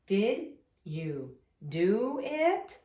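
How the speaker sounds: Did you do it, slow, voice climbing on it; rising question tune. Shown as a demonstration. Teacher showing how a question rises. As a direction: lift your voice up at the end. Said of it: "your voice goes up at the end".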